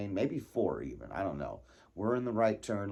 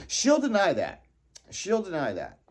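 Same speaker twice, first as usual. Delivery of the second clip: high and loud, teasing; loud teasing jab at mom; 8 semitones higher, 6 dB louder than usual. Acted. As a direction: call it out, mocking her denial. Teasing repeat about mom.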